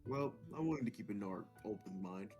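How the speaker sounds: ordinary delivery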